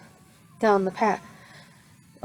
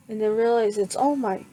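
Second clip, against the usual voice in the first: ordinary delivery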